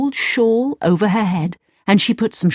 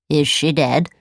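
worried dwarf; 5 semitones lower than usual. A narrator's reading. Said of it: "cried the first dwarf".

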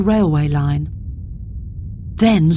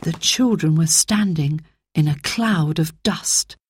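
usual speaking voice

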